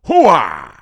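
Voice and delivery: loud catchphrase bellow, drawn out; explosive impression of the actor's signature cry; Al Pacino. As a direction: burst out with a big movie-star "Hooah". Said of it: "Al Pacino having a kid at eighty-two".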